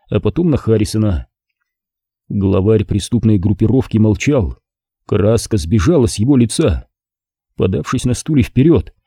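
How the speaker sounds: ordinary delivery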